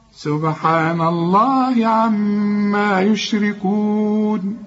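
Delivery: slow, measured tajweed chanting; Quran recitation. Acted. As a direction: recite each verse slowly with full tajweed. Recites the surah slowly.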